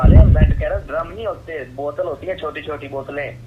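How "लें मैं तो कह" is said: loud, fed-up outburst; exasperated shout at a baffling customer. Real, not acted. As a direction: snap back in frustration.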